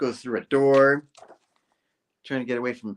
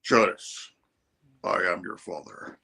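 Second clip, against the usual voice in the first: mock-solemn father; 5 semitones lower than usual. Tombstone revealing himself to his daughter, low mock-solemn voice. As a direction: intone it like a villain's big reveal.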